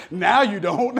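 loud, amused tease through laughter; laughing. Real, not acted. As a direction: say it while cracking up. Cracking up at his own tease.

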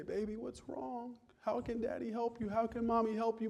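put-on soft, high baby-talk; soothing parent. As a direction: gently comfort a crying baby in a small high voice.

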